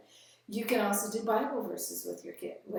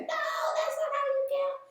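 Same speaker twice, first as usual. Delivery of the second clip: high mock shout of children; kids screaming. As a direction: imitate a chorus of kids protesting. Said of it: "kids are all gonna be screaming".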